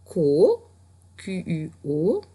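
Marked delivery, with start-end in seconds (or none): 0.1-0.6 ko dit fort et détaché; son épelé. Shown as a demonstration. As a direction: articuler ko pour la dictée. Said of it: "tu pouvais écrire". (ko bien articulé)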